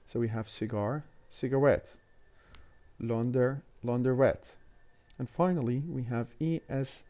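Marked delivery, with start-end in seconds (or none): 1.3-2.0 "cigarette" stressed on a final RETTE; stress on the suffix. Shown as a demonstration. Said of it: "this suffix will carry the stress". 3.8-4.5 "launderette" stressed on a final RETTE; stress on the suffix. Shown as a demonstration. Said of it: "this suffix will carry the stress".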